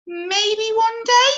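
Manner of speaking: not quite convinced, drawn out; hesitant caterpillar. Acted. Cody unsure.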